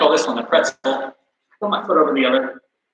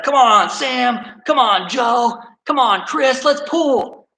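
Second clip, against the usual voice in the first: high-pitched rallying holler; yelling names; 9 semitones higher, slower than usual. Retold or imitated. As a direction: urge a team on with drawn-out, high calls. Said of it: "he starts yelling other names".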